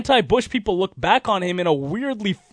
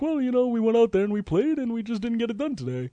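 soft, higher sing-songy imitation; Bobby Valentine; 4 semitones higher, 7 dB quieter than usual. Host mocking the manager explaining a loss, sing-songy. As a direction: lilt through it like a mild-voiced manager. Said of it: "like, sing-songy voice".

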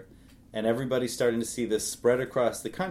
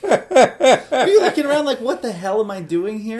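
laughing; 8 semitones higher, 7 dB louder than usual. Pretend. Host voices bewildered soldier.

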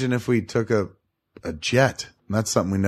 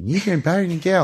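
lightly voiced imitation of a friend, a bit higher; mock voice of friend; 8 semitones higher than usual. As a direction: tease him by copying his voice. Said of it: "Stop using that voice for me".

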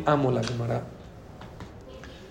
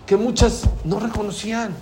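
ordinary talk

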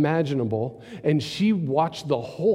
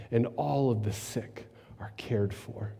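whispered hope; 3 semitones lower, 9 dB quieter than usual. Real, not acted. Preacher imagines the sick cared for, in a whisper. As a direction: breathe it like a prayer. Hopeful vision of a healed world.

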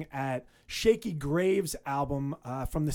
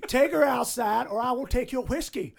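laughing, louder saloon barkeep voice; Western barman; 7 semitones higher, 6 dB louder than usual. Pretend. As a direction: play the gruff saloon bar back. Plays Western bar back.

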